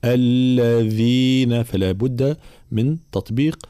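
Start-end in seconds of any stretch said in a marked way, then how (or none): 0.0-1.6 الذين drawn out slowly with a continuous buzzing "dh"; voiced, soft dhal. Shown as a demonstration. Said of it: "مجهورة ورخوة".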